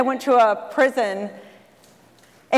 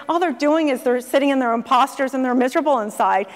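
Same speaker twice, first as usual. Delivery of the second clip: normal talking voice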